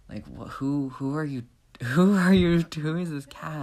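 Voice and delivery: breaking into a laugh, high and loud; cat losing it with incredulous laughter; who are you, cracking up mid-line; laughing outburst. Acted. Cat indignant, laughing, voice rising.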